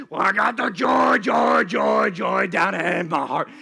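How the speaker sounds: loud, deep, growly singing; a sour teacher belting a joy song. Retold or imitated. Teacher leads the Bible class song, deep and growly. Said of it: "had this deep, growly voice".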